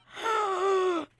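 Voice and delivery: high, loud sucked-in breath; loud gasp. Real, not acted. Streamer recovers from laughing at meme, loud gasp. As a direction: gasp for air after laughing.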